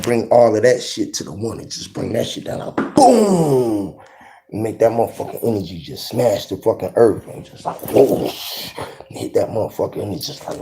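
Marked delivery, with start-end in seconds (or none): 2.9-4.0 drawn-out high boom, falling in pitch; booming impact sound. Retold or imitated. Imitating energy smashing down. 7.9-8.7 voiceless rushing whoosh; imitated whoosh. Imitating energy hitting the earth.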